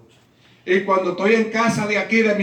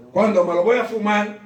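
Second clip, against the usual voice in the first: normal talking voice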